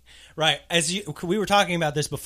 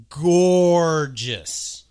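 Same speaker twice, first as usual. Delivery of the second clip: loud, drawn-out gushing; gushing praise; 3 semitones higher, 9 dB louder than usual. Real, not acted. Co-host admiring the actress, gushing.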